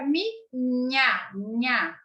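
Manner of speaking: "mi, nha, nha" broken into syllables, palatal nya. Shown as a demonstration. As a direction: separate "mi" from "nha", then repeat "nha". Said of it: "A gente muda o som da sílaba".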